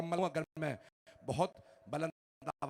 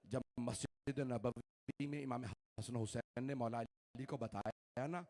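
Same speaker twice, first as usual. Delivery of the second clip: ordinary talk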